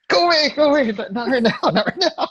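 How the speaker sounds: very high squealed plea, breaking into laughter; playful falsetto shriek, shooing someone off; mock panicked shooing. Guest shooing away people texting mid-interview, high laughing squeal. Acting out reply to texting friends.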